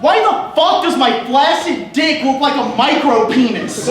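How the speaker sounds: loud, high, indignant disbelief; mock outrage at own body; exasperated outburst. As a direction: demand an answer from the universe. Exasperated quote of own reaction.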